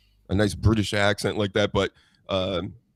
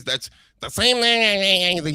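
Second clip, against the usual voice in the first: nasally impression; 9 semitones higher, much slower than usual. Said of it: "He talks like this, the nasally voice".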